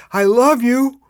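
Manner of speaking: urgent, lifted declaration of love; calling out. Retold or imitated. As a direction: call it out high and loud, as if through chaos. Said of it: "another voice going".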